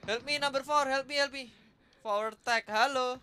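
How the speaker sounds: high, loud urgent calls for help; calling for help. Real, not acted. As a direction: yell for backup mid-fight. Calling teammates for help in firefight. (teriak minta tolong)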